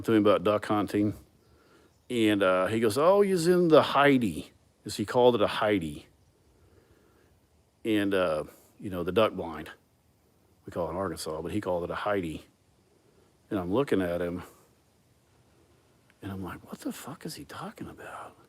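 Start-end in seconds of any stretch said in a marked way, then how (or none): 3.0-4.5 loud, higher-pitched Aussie imitation; exaggerated Australian accent; imitated Australian accent. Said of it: "so his accent was like". 16.7-18.4 hushed, high-pitched baffled whisper; retold bewildered inner thought, whispered; puzzled aside.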